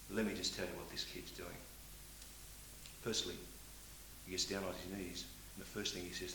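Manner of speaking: father sharing his son's prayer, softly; quietly. Real, not acted. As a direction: draw the audience in close with a hush. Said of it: "I'll say this one quietly".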